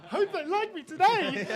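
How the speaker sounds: high, drawn-out mock substitute teacher. Host teasing a comic about his approach, mock substitute teacher. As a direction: put on a slow, high, hopeful voice. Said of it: "taking the substitute teacher approach".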